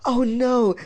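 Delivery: high-pitched dismayed exclamation; dread. A narrator's reading. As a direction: cry out as trouble looms. Dreading dad becoming furious.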